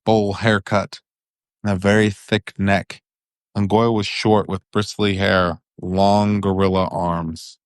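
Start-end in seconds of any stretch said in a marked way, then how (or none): none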